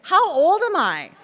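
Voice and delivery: high, loud mock exasperation; self-mocking exclamation. Real, not acted. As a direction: scold yourself with a laugh.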